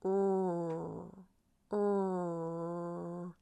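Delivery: long, level "er" as bare R; pure R sound. Shown as a demonstration. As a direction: hold "er" as a steady R hum. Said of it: "R is pronounced".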